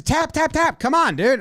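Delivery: high, repeated prodding; imitated urging. Player urges a challenge on the pitch, high imitated prodding. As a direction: push the words up high, urging. Voicing urgent appeal for a tap.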